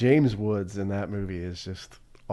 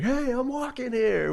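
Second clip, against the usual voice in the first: mock New York yell; 13 semitones higher than usual. People making fun of the line, high mock yell. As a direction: toss off the famous line as a parody.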